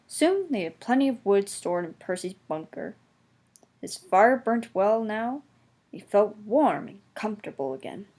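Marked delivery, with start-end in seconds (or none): none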